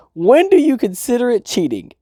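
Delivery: put-on high girlish voice for Chizu; Chizu's voice. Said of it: "And Chizu asks him".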